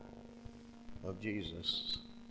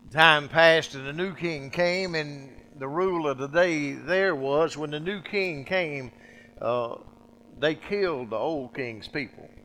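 preaching; 6 semitones higher, 10 dB louder than usual. Acted. Preacher telling the congregation, full-force preaching. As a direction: drive the story home from the pulpit.

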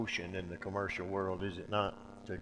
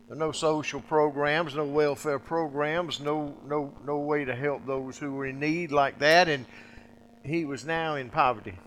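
preaching; 6 semitones higher, 8 dB louder than usual. Acted. Preacher telling the congregation, full-force preaching. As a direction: drive the story home from the pulpit.

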